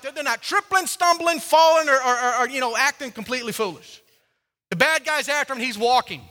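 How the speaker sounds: loud, high, driving sermon voice; full-force preaching. Acted. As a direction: preach it hard to the crowd.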